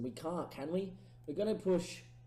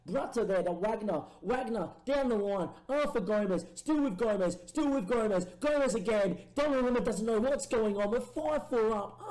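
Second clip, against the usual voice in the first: higher, louder play-by-play ending in a laugh; excited commentary; 7 semitones higher, 5 dB louder than usual. Real, not acted. Gamer calling his fifth goal, laughing and excited. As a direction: ride the attack up to the goal.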